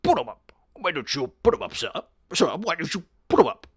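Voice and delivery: mock tough-guy taunt; taunting fighter. Mocking the posturing fighter's taunt. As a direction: challenge him to fight, puffed up. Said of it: "They look like they're about to go".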